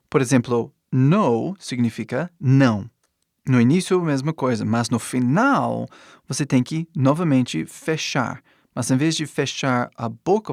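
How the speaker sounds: ordinary talk, nothing marked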